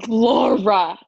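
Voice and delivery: gasp, then say the name in playful outrage; feigned scandalized gasp; mock shock. Mock shock at friend's trade.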